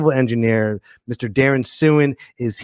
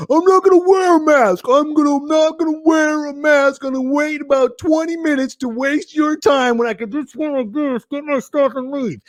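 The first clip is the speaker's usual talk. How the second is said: mock complainer; 12 semitones higher than usual. Host mimicking customer refusing a mask, mocking. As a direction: imitate a ranting customer, pitched way up.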